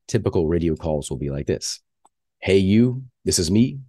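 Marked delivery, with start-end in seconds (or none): none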